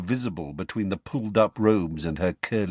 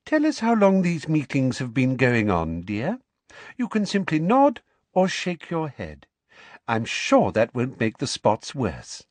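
Umbridge voice; 6 semitones higher than usual. Acted. Umbridge coaxing a student.

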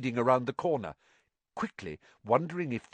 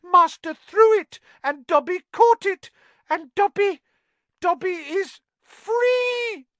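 ecstatic house elf; 8 dB louder than usual. Acted. Dobby rejoicing.

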